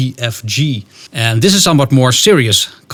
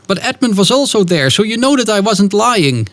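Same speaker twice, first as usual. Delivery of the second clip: put-on lightly raised, insistent girl's voice; Lucy defending herself to her siblings; pleading little sister; 8 semitones higher than usual. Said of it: "Lucy says to their brother and sister".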